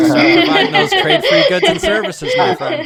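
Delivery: high, laughing through the words; a laughing jab at a friend. Real, not acted. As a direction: tease them while cracking up. Joking at the friend's expense.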